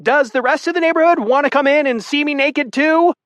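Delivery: high, sarcastic woman's voice; mock the onlookers near tears; embarrassed naked woman scolding the neighbors; hysterical sarcasm. A narrator's reading.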